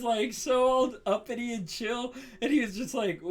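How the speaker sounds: normal talking voice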